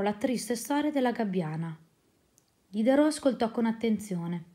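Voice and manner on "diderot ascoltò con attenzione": ordinary delivery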